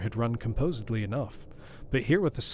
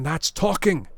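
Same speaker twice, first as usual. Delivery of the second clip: louder, higher approving cry; eager cry; 6 semitones higher, 6 dB louder, slower than usual. A narrator's reading. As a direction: cheer the lawyer on. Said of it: "cried the butler".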